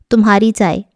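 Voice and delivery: usual speaking voice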